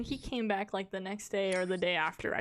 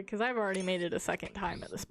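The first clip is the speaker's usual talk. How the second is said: ordinary delivery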